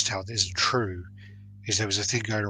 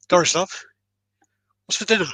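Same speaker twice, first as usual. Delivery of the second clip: higher, louder call across the room; 9 semitones higher, 8 dB louder than usual. Retold or imitated. Husband calls loudly to his wife across the house. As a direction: shout it over twenty feet.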